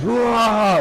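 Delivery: high, loud battle cry; a big, joyful rallying yell; Gandalf's rallying shout. Retold or imitated. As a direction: call the army in like a wizard on a hill. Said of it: "and he just goes".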